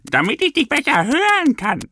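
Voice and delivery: put-on very high squeaky old granny voice; bloated granny answering the girl, very high; granny's answer. A narrator's reading. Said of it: "die Großmutter antwortete".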